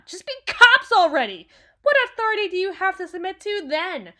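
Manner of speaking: loud, high angry shouting; furious rant. Acted. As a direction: let the exasperation explode. Full angry outburst at the situation.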